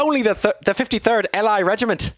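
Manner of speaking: high-pitched character voice with a put-on Irish lilt; Captain Cherry's Irish-style accent. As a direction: play the officer reporting losses in a high, hammed-up Irish accent. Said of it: "That's a great accent".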